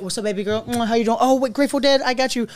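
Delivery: high, chummy mock greeting; bubbly put-on welcome; friendly bartender act. Acts friendly bartender serving her friends.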